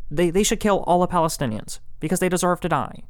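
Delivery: hammed-up smug political pundit; Ben Shapiro impression. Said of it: "He talks like Ben Shapiro".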